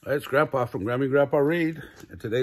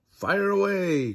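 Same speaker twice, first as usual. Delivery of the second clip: launch command; 7 semitones higher, 4 dB louder, slower than usual. A narrator's reading. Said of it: "launch command".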